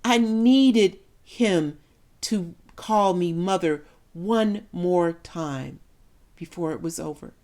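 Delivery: very slow, stretched, loud start; aching longing. Acted. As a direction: draw out every word of need. Mother's longing at the cross.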